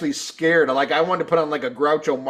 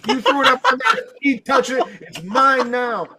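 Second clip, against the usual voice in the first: high-pitched hammed-up defiance; mock indignant retort; 9 semitones higher, 3 dB louder than usual. Drunk fan refusing to return shirt, mock indignant. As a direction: retell a squabble, gleefully refusing. Retold quarrel over the shirt.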